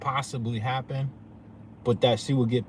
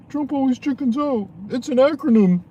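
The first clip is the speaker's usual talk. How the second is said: mock media voice, much higher and louder; mocking high squeal; 12 semitones higher, 10 dB louder than usual. Vlogger ridiculing the media's slogan, in a mocking high squeal.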